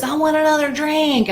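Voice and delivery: put-on high childish demand; toddler wanting a drink. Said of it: "downstairs toddler goes".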